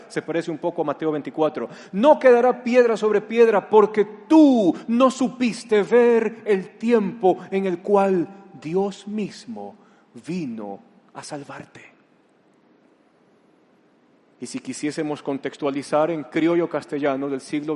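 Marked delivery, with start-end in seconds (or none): 1.9-12.0 loud lament fading. Acted. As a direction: cry it out slowly, then let it sink. Jesús lamenta ante Jerusalén. (lamento lento y fuerte que se apaga)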